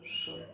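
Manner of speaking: श as pure air, slow, soft; whistling sh. Shown as a demonstration. Teacher contrasting the airy श, slow soft hiss. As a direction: let श hiss out, no voice. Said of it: "हवा निकलती है श".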